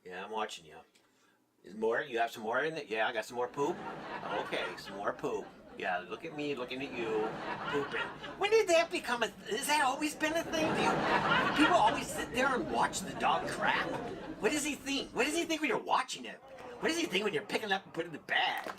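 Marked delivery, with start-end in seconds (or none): 0.1-8.0 lightly voiced neighbours talking to dog; dog owner voice. Neighbours watching their dog poop, low mock voice. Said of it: "like, watching their dog". 8.4-17.8 pitched up, laughing disbelief; incredulous. Real, not acted. Incredulous questions.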